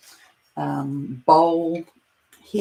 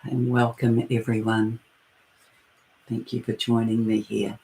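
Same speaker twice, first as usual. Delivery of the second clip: guided meditation; 2 semitones lower than usual. Acted. Leading listeners into their breath and heart space, guided meditation. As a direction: ease listeners inward, phrase by unhurried phrase.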